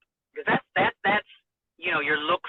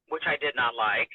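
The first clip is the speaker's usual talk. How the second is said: ordinary talk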